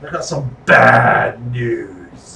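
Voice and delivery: slow, stretched-out wrestler catchphrase; Wade Barrett. Retold or imitated. Fan imitating his favorite wrestler, slow drawn-out impression. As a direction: drag out the word bad, mock ominous. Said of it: "Wade was one of my favorites".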